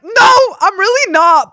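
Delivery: excited denial. Retold or imitated. Denying fame to the Egyptian fans.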